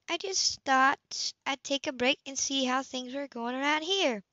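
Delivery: lightly voiced Anna dropping by. A narrator's reading. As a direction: gently lift the voice for Anna, casual. Anna's voice in the story.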